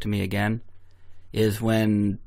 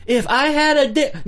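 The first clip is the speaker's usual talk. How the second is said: mock girly voice; 5 dB louder than usual. Joking high put-on voice.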